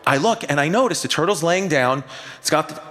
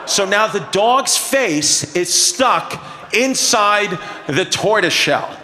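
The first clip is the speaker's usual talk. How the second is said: usual speaking voice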